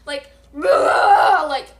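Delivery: loud revving dirt-bike noise, drawn out; imitated engine roar. Vlogger mimicking the runaway dirt bike, loud roaring noise.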